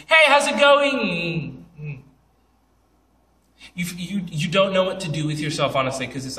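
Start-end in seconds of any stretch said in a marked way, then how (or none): 0.1-1.6 high, loud, drawn-out greeting; slow, high sing-song hello; imitating the odd announcer. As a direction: stretch the last word out on a high note. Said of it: "if I were to come up to you and say".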